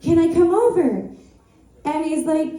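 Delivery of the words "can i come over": gushing. Retold or imitated. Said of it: "I'm like".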